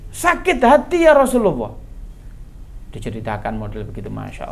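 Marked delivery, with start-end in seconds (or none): 0.1-1.8 high acted lament; anguished cry. Mother telling the Prophet of her hurt, anguished cry.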